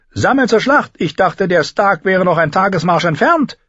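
high, forceful character voice; startled, high-voiced outburst; alarmed lord. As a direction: exclaim it in alarm, pitched well up. Tyrion alarmed by battle call.

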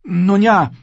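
put-on high, girlish Arya voice; young girl voice. A narrator's reading.

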